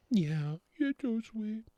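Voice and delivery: very high, soft cooing voice. Real, not acted. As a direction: coo gently to a little one. Cooing at little one.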